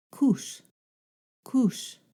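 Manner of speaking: "coos" ending in a hiss between s and sh; Yurok S. Shown as a demonstration. Teacher shows the sound in a word, sh-like final S. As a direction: end "coos" almost like "koosh". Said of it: "you can hear it in the word".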